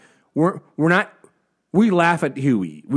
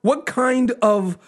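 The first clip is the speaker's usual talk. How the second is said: mock-earnest infiltrator, higher and drawn out; 5 semitones higher, slower than usual.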